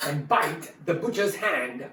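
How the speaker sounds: ordinary delivery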